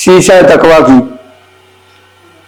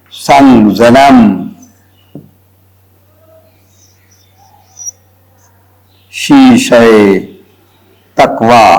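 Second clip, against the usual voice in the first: very slow recital with long pauses; verse dictation; 2 semitones lower, slower than usual. Shown as a demonstration. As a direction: dictate the Persian couplet phrase by phrase. Dictates a Persian couplet. (शेर को रुक-रुक कर, धीरे-धीरे लिखवाते हुए)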